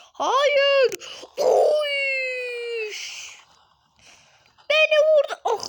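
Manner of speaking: high, loud crying shout; terrified cry. Acted. Bear being hit on the head, crying out in terror. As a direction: scream for your life, way up high.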